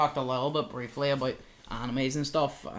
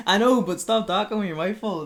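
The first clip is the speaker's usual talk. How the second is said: laughing; 8 semitones higher, 8 dB louder than usual. Real, not acted. Laughing scolding friend eating.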